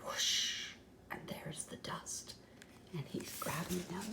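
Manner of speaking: soft voiceless storytelling; hushed whisper. Real, not acted. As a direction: whisper the scary moment.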